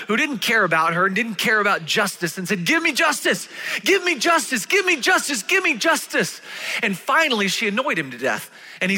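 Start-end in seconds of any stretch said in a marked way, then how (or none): 2.6-6.4 high, loud repeated demand; desperate plea. Acted. Widow pleading day and night with the judge, high and loud. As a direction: keep pounding the same plea. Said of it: "so desperate for justice".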